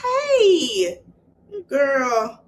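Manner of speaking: high, drawn-out fake-friendly hello with a laugh; fake sweet greeting. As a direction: gush over her like you secretly can't stand her. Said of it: "The mama fake too".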